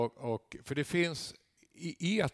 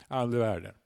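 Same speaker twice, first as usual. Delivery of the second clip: ordinary talk, nothing marked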